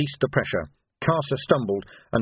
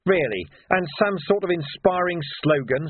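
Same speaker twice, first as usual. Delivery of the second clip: mock-interested, higher pitch; sarcastic; 7 semitones higher than usual. A narrator's reading. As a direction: feign keenness with a sarcastic edge. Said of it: "said Vimes sarcastically".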